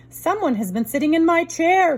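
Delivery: a hint of a big rough bear; great big rough voice. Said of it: "in his great big rough voice".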